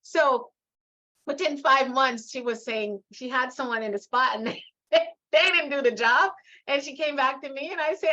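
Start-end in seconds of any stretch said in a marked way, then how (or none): none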